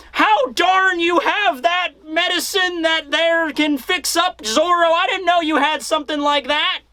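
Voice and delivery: put-on creaky old geezer, slowed down; old prospector. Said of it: "grizzled prospector as an old man".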